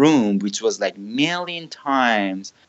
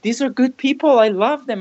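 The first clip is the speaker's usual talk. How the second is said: pitched way up, pleading why, bewildered and hurt; baffled protest; 9 semitones higher, faster than usual. Retold or imitated. Confused believer questioning an angry God.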